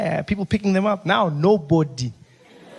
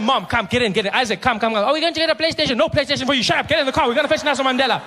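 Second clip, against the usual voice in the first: rounding up family; 6 semitones higher, faster than usual. Retold or imitated.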